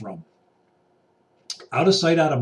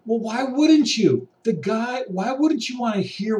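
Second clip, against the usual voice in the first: indignant; 6 semitones higher than usual. Real, not acted. Indignant rebuttal, raised pitch.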